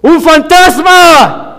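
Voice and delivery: high, slow, loud scream of fright; a frightened disciple shrieking at a ghost; terrified cry. Acted. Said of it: "gritaban de miedo".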